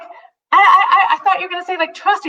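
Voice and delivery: high, flustered laugh through the words. Retold or imitated. Wife laughs in disbelief at her husband's answer, giggling. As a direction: giggle it out, caught off guard.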